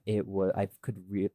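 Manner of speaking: ordinary delivery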